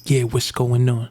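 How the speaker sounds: slow, unshouted Brooklyn greeting; Brooklyn vet, not yelling. Retold or imitated. Host corrects how the vet greeted them, slow Brooklyn accent. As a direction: imitate a slow-talking Brooklyn guy. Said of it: "he talked very, he didn't yell".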